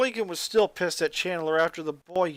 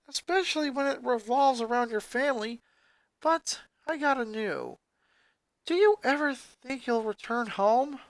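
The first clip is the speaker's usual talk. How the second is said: higher, earnest best-friend voice; 8 semitones higher than usual. Acted. Clyde voiced, much higher.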